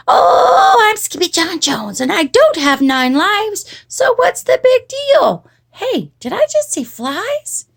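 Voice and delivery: put-on high, bouncy boyish voice; cocky kitty boy. A narrator's reading. Kitty boy bouncing on his bed, high and cocky. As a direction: sing out carefree as a bouncing kitten. Kitten boy talks to himself.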